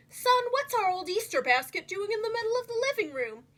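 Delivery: a hint of a puzzled father; lightly voiced dad, curious; Dad's voice. A narrator's reading. Dad asks his son about the basket, lightly voiced puzzled.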